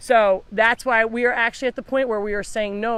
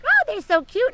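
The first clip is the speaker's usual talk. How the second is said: much higher gushing squeal, mocking; mocking gushing buyers; 4 dB louder than usual. Rescuer mocks people who buy cute ducklings, high gushing voice. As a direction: imitate buyers cooing over baby ducks. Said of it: "they're still like".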